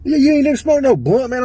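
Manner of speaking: high, loud scoffing imitation; mocking disbelief. Dealer scoffing at his refusal.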